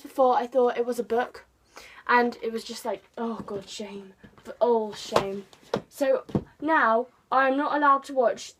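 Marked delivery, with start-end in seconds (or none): none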